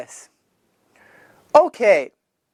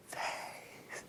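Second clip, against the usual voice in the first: whispered learner reply; 31 dB quieter than usual. Pretend. Voice of a learner answering.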